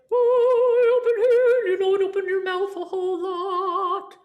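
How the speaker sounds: high, far-back open dome sound; inner dome. Shown as a demonstration. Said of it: "we call inner dome".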